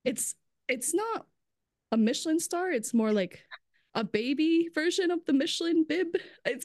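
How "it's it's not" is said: usual speaking voice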